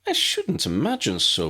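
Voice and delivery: high, gentle reassurance; reassuring. A narrator's reading. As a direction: set his mind at ease. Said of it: "McGonagall assured him".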